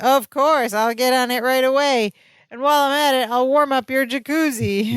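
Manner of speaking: sarcastic guard. Retold or imitated. Prison guard answering a fussy inmate, full of sarcasm. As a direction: play up fake obliging service. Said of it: "full of sarcasm".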